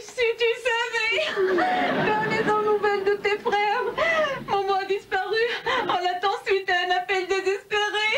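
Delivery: hammed-up sobbing lament; tearful wailing. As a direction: pour out the bad news through sobs. Mother tearfully reports family disasters.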